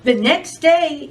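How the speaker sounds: ordinary delivery, nothing marked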